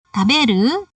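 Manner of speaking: "Taberu" with the pitch climbing at the end; rising intonation. Shown as a demonstration. Teacher shows how to ask a listener a question, rising intonation. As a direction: ask "Taberu" as a question, voice lifting on the last syllable. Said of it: "intonasi naik".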